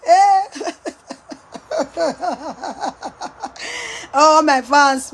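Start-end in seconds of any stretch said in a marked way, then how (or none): 0.5-4.1 long rising laugh fading out. Real, not acted. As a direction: laugh until it fades. Long laugh after chant.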